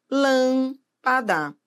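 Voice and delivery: slow Lâmpada, LÂM held long; stress on antepenultimate syllable. Shown as a demonstration. Teacher showing a proparoxítona, long LÂM in slow Lâmpada. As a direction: stretch LÂM, then separate PA and DA in Lâmpada. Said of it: "A sílaba tônica é a antepenúltima sílaba".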